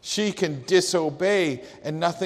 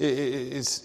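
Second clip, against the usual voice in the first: ordinary talk, nothing marked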